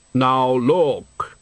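slow, hollow, solemn deathly voice; Death's voice. Acted. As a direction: speak as grave, patient Death. Death speaks.

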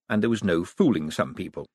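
usual speaking voice

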